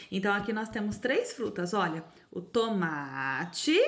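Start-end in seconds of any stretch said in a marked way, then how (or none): 2.3-3.8 drawn-out teacher's "tomate", each syllable stretched; slow syllable-by-syllable "tomate"; slow syllable demo. Shown as a demonstration. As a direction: sound out "tomate" slowly for children. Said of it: "olha só, ó, o to".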